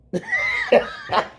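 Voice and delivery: short breathy bitter laugh. Real, not acted. Laughing at the mayor's answer on TV, bitter laugh. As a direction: laugh it off to keep from being mad. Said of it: "I'm laughing to keep from being mad".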